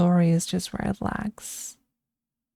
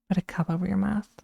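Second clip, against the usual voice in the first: usual speaking voice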